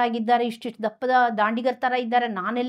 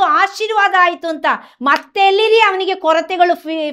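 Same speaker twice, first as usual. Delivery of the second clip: fervent preaching; 3 semitones higher, 4 dB louder than usual. Acted. Preacher assures listeners Jesus wants their heart, in fervent preaching. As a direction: proclaim it like a revival preacher.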